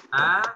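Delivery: loud, clear open A, not O; thin A. Shown as a demonstration. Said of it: "bukan O".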